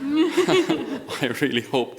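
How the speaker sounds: slow words through real laughter; laughing. Real, not acted. Preacher laughing at the hikers' joke, slow and amused. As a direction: let the laugh carry the line, slowly. Laughing while retelling hike.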